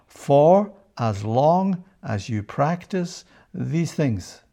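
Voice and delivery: slow, weighted verse reading, every word placed; slow scripture reading. Acted. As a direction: let each word of the promise land slowly. Reads the verse slowly, weighing it.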